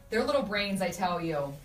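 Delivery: ordinary talk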